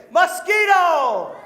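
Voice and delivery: drawn-out, loud and high shout; big shout. Pretend. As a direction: belt the word out for the kids to echo. Kids shout answer together.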